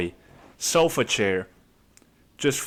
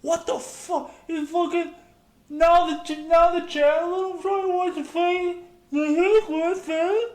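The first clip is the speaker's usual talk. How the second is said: squeaky, much higher mock tantrum; yelling at the TV. Couch potato yells at the static on the TV, squeaky tantrum. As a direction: squeal at the static like a spoiled child. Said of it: "they start yelling at the T V".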